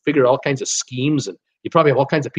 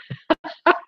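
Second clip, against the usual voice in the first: high laugh. Real, not acted. Laughs at deserted island comment.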